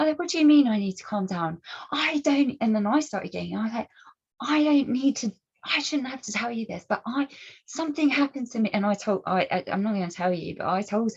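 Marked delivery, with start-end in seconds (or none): 0.2-2.6 louder and higher, pushing back indignantly; indignant retort. Retold or imitated. Arguing back at the woman. 4.4-8.7 higher, strained plea to be understood; upset pleading. Retold or imitated. Explaining her trauma in argument.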